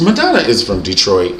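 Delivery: usual speaking voice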